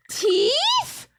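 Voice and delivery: squeaky high disbelieving "teeth?"; shocked. Acted. Player reading vomit scene, shocked. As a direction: voice leaps up in horror. Horror reveal in text.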